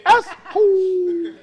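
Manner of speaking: long, high, hammed-up yell of assholes; drawn-out yell. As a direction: stretch it out and holler it slowly. Repeats the mocking yell.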